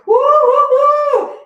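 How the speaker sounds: high, loud whooping cheer; excited cheering whoops. Real, not acted. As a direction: whoop it up like a hype coach. Cheering during one-leg bridge.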